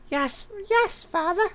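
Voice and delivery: very high-pitched girl voice, put on by a man; squeaky daughter voice. Daughter brushing off her father, very high squeaky voice. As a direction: squeak it out like a sulky young daughter. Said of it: "Doing female voices is not my forte".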